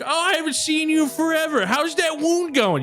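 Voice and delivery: very high, gushing little voice; excited small friend. Acted. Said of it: "You see Hugh is".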